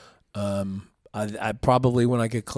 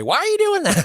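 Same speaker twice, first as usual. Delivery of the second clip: much higher, puzzled put-on voice of the old coach; mock coach voice; 6 dB louder than usual. Coach quizzing a lifter, in a high mock voice. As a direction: play the baffled gym boss, pitched way up. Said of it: "he would come and say".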